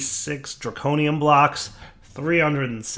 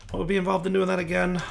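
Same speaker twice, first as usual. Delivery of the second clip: quick question; 7 semitones higher, faster than usual. Acted. Mock question, quick asked.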